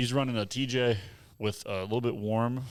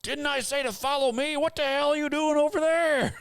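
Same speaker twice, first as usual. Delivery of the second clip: much higher, louder mock yell of an exasperated guide; ironic gentlest voice; 4 dB louder than usual. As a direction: holler it far up like a fed-up trail guide across the woods. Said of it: "in his gentlest voice to ever".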